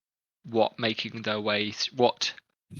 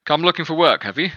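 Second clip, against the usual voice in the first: loud, high captain voice; gruff guard captain; 5 semitones higher, 6 dB louder than usual. Acted. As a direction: boom it down from the crate. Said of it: "and says".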